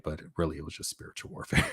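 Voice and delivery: fast conspiratorial under-the-breath reveal; hushed aside. Acted. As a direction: drop it to a breathy secret as the punchline. Punchline aside about being fired.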